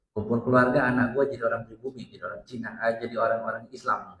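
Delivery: lightly voiced mother grumbling about family shame; scolding Chinese mother. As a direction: mutter it like a disappointed mother.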